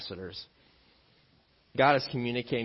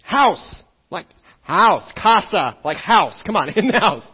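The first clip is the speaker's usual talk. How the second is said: exasperated dad calling; 8 semitones higher, 13 dB louder than usual. Retold or imitated. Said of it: "And I'm like".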